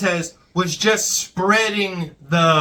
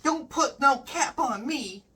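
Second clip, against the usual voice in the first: put-on high, soft, chirpy clean-cut kid voice; wholesome 1950s kid; 7 semitones higher, 5 dB quieter, slower than usual. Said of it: "the equivalent of "Hiya, pal".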